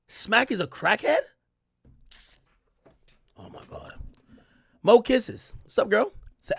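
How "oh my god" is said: breathy stunned whisper under the breath; a real whispered oh my God; whispered shock. Real, not acted. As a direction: whisper it in disbelief. Stunned aside after crackhead news.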